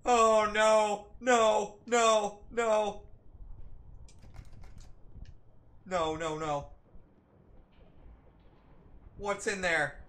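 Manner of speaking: loud, high panicked no; scared out of your wits; terrified. Real, not acted. Being chased in horror game.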